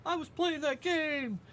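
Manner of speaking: high, slow sing-song voice; high squeaky voice. Retold or imitated. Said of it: "I'm like".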